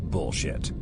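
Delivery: ordinary delivery, nothing marked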